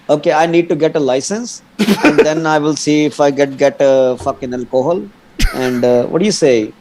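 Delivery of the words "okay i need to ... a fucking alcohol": hammed-up Indian accent, laughing through it; exaggerated Indian accent. Shown as a demonstration. Guest mocks his own Indian accent for the hosts. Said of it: "You want me to say in Indian accent".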